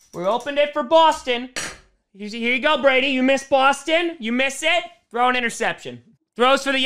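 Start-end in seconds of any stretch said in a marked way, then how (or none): none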